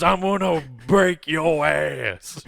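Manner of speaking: slow gritty promo threat; wrestling promo voice. Acted. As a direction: growl it like a wrestler. Stone Cold wrestling promo parody.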